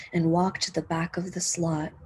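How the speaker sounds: ordinary delivery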